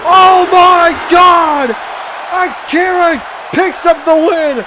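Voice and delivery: slow, stretched-out high shouting; excited shout. Acted. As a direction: scream the finish, drawn out. Finish of the match.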